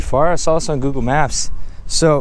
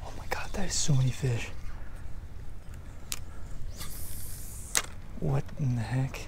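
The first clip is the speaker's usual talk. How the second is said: hushed excited whisper, breath only; amazed whisper at the water's edge; 15 dB quieter than usual. Real, not acted. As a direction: whisper so the fish don't spook. Whispering while watching fish.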